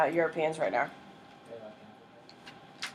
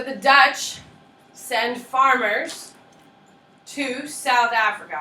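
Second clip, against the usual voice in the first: slow, spaced-out dictation pace; 4 semitones higher, 4 dB louder, slower than usual. Shown as a demonstration. Teacher slowing down so students copy notes. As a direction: spell it out slowly for note-taking. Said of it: "I'd write that down".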